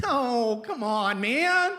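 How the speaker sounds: high-pitched pleading protest; mock protest. Patient's husband to nosy doctor, pleading protest. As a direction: whine it, pitch jumping up on 'man'. Begging off questions about guns.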